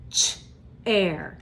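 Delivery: "Ch, air" split into onset and rime with a pause; segmented again. Shown as a demonstration. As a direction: model "Ch" then "air" slowly. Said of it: "Let's try it again".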